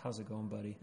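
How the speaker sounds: barely into the mic, soft and easy. Real, not acted. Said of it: "we might need to talk a little bit louder than that".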